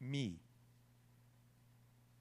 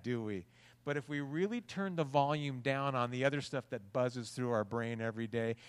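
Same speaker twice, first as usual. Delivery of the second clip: ordinary talk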